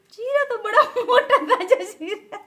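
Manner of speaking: ऊँची, धीमी, हँसी भरी नकल; पापा का ताना हँसते हुए दोहराओ; पापा की हँसती नकल. Retold or imitated. (हल्की सी पापा की नकल, हँसी के साथ)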